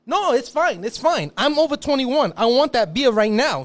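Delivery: high, loud exasperated demand; irate bar customer; indignant complaint. Retold or imitated. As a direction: argue with the cashier for beer. Customer refusing help, angry.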